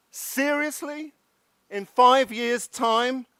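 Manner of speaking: high-pitched, disbelieving question; incredulous. Real, not acted. Speaker objecting to a far-off meeting date, incredulous. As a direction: ask it in pointed disbelief.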